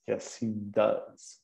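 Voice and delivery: slow, soft, clear short answer; slow model answer. Shown as a demonstration. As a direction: say it slowly for the class. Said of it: "[name], como é que é?".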